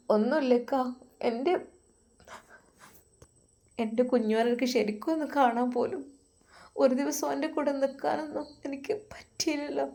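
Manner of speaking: tearful wife. Acted. Wife voiced, after crying.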